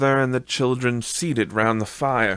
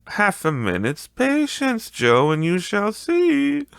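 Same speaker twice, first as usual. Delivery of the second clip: a high, slow wheedling woman's voice; high character voice; 13 semitones higher than usual. Acted. A woman coaxing old Joe to wait, high and slow. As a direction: pitch it up high and coax patiently.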